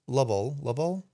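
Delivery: "Lovell" stressed on the first syllable; testing "Lovell" as LUV-ul; trying a pronunciation. Shown as a demonstration. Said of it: "I'm so bad with names".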